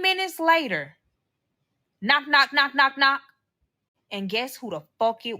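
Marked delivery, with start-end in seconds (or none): 2.0-3.2 loud, high, rhythmic knocks; spoken knocking. Retold or imitated. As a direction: imitate insistent pounding on a door. Voices knocking at the door.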